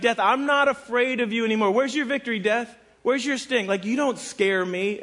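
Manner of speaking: high-pitched, defiant taunting; trash talk. Acted. Preacher voicing Paul taunting death, trash talk. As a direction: taunt a beaten opponent, pitched well up. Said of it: "here's where he gets the trash talk".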